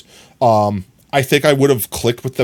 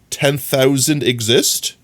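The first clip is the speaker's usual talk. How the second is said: ordinary talk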